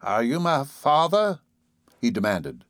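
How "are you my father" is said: put-on slow, high old man's voice; creaky old-timer, drawn out; cracked ancient voice. A narrator's reading. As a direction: ask it in a cracked, aged voice. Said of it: "spoke in a cracked and ancient voice".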